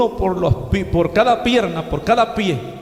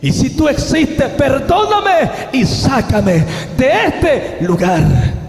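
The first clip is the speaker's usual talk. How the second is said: slow, loud cry to God, higher; shouted plea; 4 semitones higher, 3 dB louder, much slower than usual. Retold or imitated. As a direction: cry out for rescue, drawn out. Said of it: "y yo dije". (grito de súplica, lento y fuerte)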